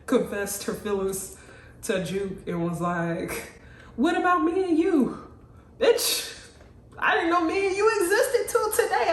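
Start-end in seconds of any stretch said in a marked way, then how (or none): 4.0-5.3 high, louder mock confession; imitating a girl confessing her feelings; pleading love interest. 5.8-9.1 high, loud mock disbelief; hammed-up incredulous retort, pitched way up; incredulous comeback.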